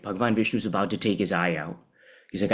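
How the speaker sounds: ordinary talk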